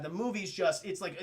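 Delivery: ordinary talk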